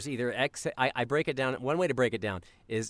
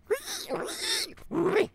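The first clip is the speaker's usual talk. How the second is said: very high squeal sucked in on the breath; inhaled pig squeal; much slower than usual. Shown as a demonstration. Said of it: "with an inhale".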